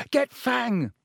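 high, urgent yell. A narrator's reading. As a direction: shout the order over the noise. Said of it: "Harry yelled".

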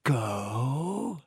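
slow, soft spider question. A narrator's reading. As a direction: let the word hang slowly. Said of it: "said Aragog slowly".

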